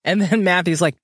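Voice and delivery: usual speaking voice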